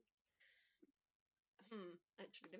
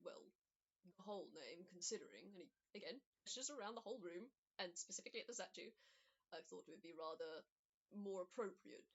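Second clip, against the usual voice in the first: low resigned voice; 7 semitones lower, 5 dB quieter than usual. Acted. Shuffle speaks lowly, resigned.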